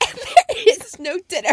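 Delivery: laughing through the words, high; giggling disbelief. Real, not acted. Cohost laughing at the missing dinner, high.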